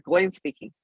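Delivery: usual speaking voice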